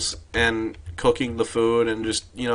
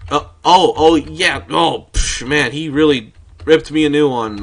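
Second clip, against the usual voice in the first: feigned dismay; 3 semitones higher, 8 dB louder than usual. Pretend. Feigns having been scolded by principal.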